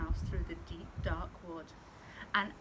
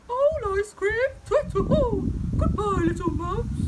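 high, loud alarmed owl; tut-tut and flee like a scared owl; 13 semitones higher, 11 dB louder than usual. Acted. Owl flees in story.

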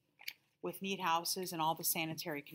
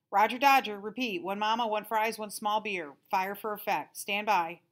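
higher, louder military radio patter; radio-talk joker; 4 semitones higher, 7 dB louder than usual. A narrator's reading. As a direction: play the wisecracking intercom guy. Intercom guy radio patter.

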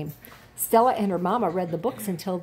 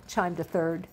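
plain reading voice

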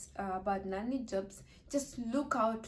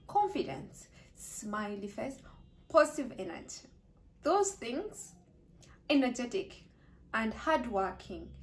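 normal talking voice

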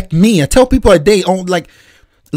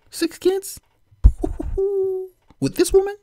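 high, soft disbelief breaking into laughter; incredulous laugh; 12 semitones higher, 9 dB quieter than usual. Real, not acted. Incredulous at six kids.

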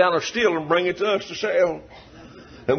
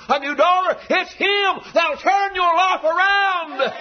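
slow preaching, climbing higher; sermon build; 5 semitones higher than usual. Real, not acted. Preacher urging the congregation, slow and rising. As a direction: drive each line home, rising.

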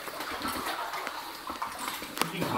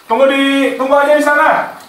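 higher, loud call across distance; 9 semitones higher, 4 dB louder than usual. Real, not acted. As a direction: call out to someone far off. Calling out to friend far away. (berseru memanggil dari jauh)

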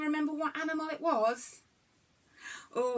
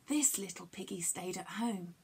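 hushed rhyme line; 5 semitones lower, 7 dB quieter than usual. Acted.

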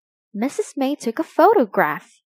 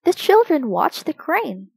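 plain reading voice

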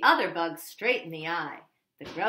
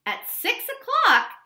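ordinary delivery, nothing marked

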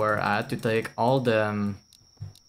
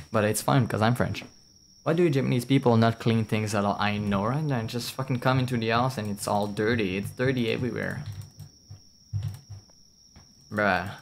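usual speaking voice

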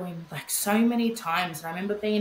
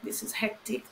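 breathy hushed whisper, much softer; whispered aside; 3 semitones higher, 7 dB quieter than usual. Retold or imitated. Mother staring at her sick baby, whispering in disbelief.

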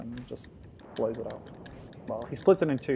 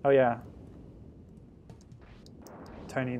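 ordinary talk

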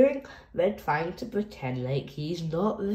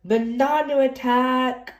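higher, louder game shout; playful battle cry; 6 semitones higher, 5 dB louder than usual. Acted. Reader voicing kids playing Rug Island, playful shout. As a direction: shout it like a kid mid-game.

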